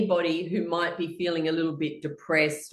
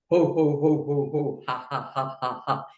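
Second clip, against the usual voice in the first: laughs building faster; jumbled laughing; 5 semitones higher than usual. Shown as a demonstration. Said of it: "get faster and faster as you go".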